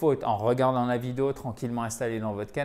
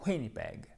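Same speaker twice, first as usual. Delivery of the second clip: Winnipeg stressed on its first syllable; 4 semitones lower, 8 dB quieter than usual. Shown as a demonstration. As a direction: lean on the start of Winnipeg, let the rest fall away. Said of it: "Accent tonique sur la première syllabe".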